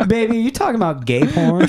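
high, laughing mock clerk voice; high laughing imitation of a shop clerk calling him baby; imitating the clerk. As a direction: play the amused shop clerk. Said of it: "And she's like".

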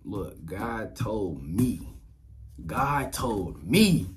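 slow, deliberate, weighty words; slow grandma warning. Retold or imitated. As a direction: spell it out slowly like a stern grandma. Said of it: "she said".

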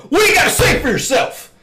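high, loud mock holler; imitated rude yell. Showing brother the wrong way to hail someone, mock yell. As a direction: bellow it as the example of how not to ask. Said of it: "Not go".

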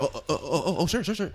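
high, stammering mock fluster; comic flustered stutter, pitch rising; flustered bystander. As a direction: imitate someone startled into agreeing. Mimicking startled restaurant worker obeying.

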